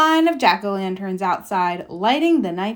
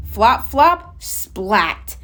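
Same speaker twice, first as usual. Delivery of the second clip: plain reading voice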